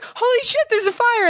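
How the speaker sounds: high-pitched panicked shout. Retold or imitated. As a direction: squeal it out in alarm. Retelling her panic at the alarm.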